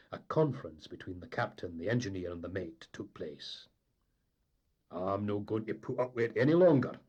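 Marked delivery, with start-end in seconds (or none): 4.9-7.0 firm, loud declaration; emphatic. A narrator's reading. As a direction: put your foot down. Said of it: "said the engineer emphatically".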